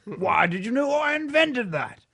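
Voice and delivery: indignant noble, stretching every word; Earl of Sandwich. Acted. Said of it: "He's an Earl. He's more silky smooth".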